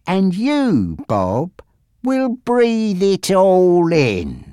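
drawn-out, lingering threat; slow menace. A narrator's reading. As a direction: take your time, savouring every word of the threat. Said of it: "Frankie continued slowly".

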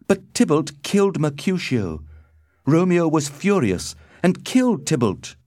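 high-pitched, insistent testimony; earnest defence. A narrator's reading. Benvolio explains the fight to the Prince, high and earnest.

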